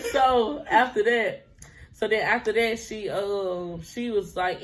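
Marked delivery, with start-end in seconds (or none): none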